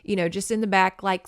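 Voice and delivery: ordinary talk, nothing marked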